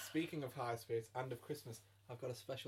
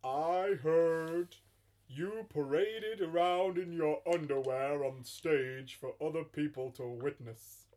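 slow, deliberate put-on Santa; hammy Father Christmas passing judgement; praise, then accuse, in Santa character; Santa, gravely accusing; 3 semitones higher, 7 dB louder, much slower than usual. Plays Santa.

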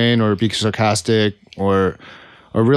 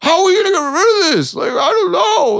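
mock whine. Whiny mock complaint.

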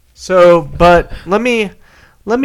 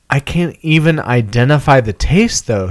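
normal talking voice

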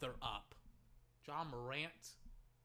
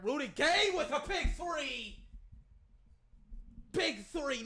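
high, loud and stretched; excited score call; 7 semitones higher, 5 dB louder, slower than usual. Real, not acted. Fan calls his team's big three, excited shout. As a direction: celebrate the shot at full voice.

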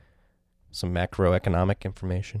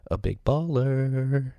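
big baller voice; 6 semitones higher, much slower than usual. Acted. Hypes big buyers.

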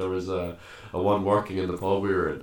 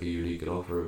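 ordinary talk, nothing marked

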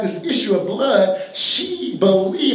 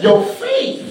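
Jesus speaks. Acted. Jesus answers the woman.

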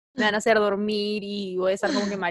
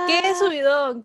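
excited; 7 semitones higher, 4 dB louder, slower than usual. Real, not acted. Celebrating end of semester.

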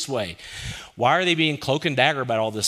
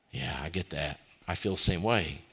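lower, soft, understanding reply; God sympathizing; 7 semitones lower, 4 dB quieter than usual. Acted. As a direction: reassure gently, lower and softer.

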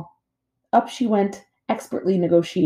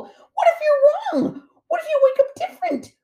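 very high, panicky little gosling voice; squawking gosling; 4 dB louder than usual. A narrator's reading. Gosling afraid to shut his mama down, squawking. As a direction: squeal it in rising alarm. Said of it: "squawked Bright Bill".

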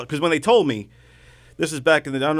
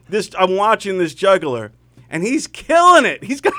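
ordinary delivery

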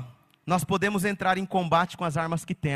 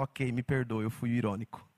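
lower, softer mock-contrite; ironic apology; 8 semitones lower, 6 dB quieter than usual. Pastor retells his ironic group-chat reply, low, soft, dry irony. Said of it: "eu senti um tom de ironia".

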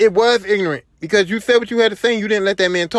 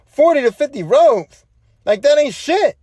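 higher-pitched mock disbelief; incredulous scoff; 5 semitones higher than usual. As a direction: throw his number back as a scornful question. Retold crowd scoffs at his figure.

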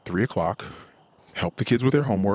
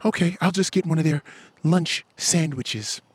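mock wife voice; 8 semitones higher than usual. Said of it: "So my wife goes to Starbucks also".

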